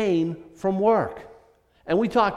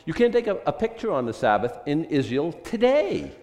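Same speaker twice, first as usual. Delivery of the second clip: ordinary delivery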